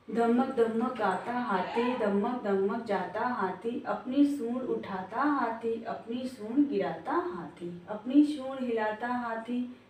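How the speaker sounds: put-on slow, rhythmic recitation of a children's poem; sing-song reading to a class of small children. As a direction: chant it in a steady beat, slowly. Teacher recites the elephant poem.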